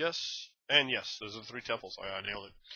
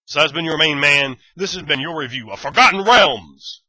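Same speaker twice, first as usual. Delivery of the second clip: loud sign-off; 5 semitones higher, 14 dB louder than usual. Real, not acted. Sign-off of the review.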